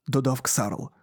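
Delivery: ordinary delivery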